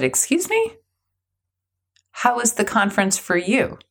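high, incredulous excuse me; indignant excuse me. Retold or imitated. The executive caught off guard by the speaker, indignant high. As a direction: sound taken aback and offended.

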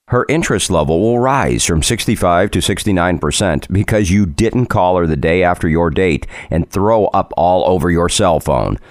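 usual speaking voice

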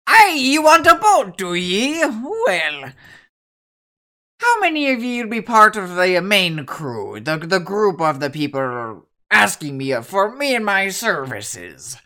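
lightly voiced cheery sea dog; hearty sailor greeting a visitor; jolly old shipwright.

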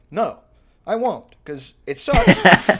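slow, drawn-out loud refusal; sarcastic reply to the gym guy; mock refusal. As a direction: drag out the no with mock defiance. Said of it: "It's like".

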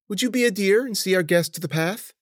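lightly voiced, high old-lady request; polite mistress. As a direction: ask the helper sweetly. Mistress asks servant a favour.

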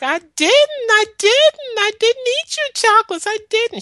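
put-on a terrified little girl swearing she's innocent; frightened child denying. Little girl begging her aunt to believe her, high and loud.

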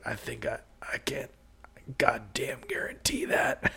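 faint, breathless dying whisper; hushed last words of a dying man. Acted.

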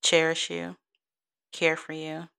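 usual speaking voice

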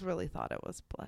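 whisper. Real, not acted. Creepy true crime detail.

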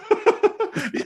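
squeaky laugh. Real, not acted. High laugh.

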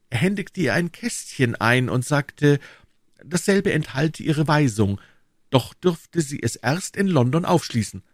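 put-on high-pitched, keen young informant; eager young D'Artagnan, reporting. Voices young D'Artagnan in dialogue. (eifrig berichtender junger Mann, hohe Stimme)

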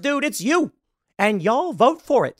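normal talking voice

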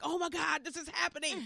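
high, awed exclaim; thrilled disbelief. Retold or imitated. Arriving at Paisley Park, high and thrilled. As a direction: marvel that it's really real.

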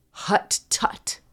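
hushed mock witch reply; witch's whispered scolding. Witch answers as the Dutch step-grandmother, whispered mock scolding. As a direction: whisper it as a clucking rebuke.

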